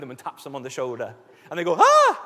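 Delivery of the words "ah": sudden loud, high gasp of fright; hammed-up startled "Ah!". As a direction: jump with a mock shriek. Startled disciples in retold story.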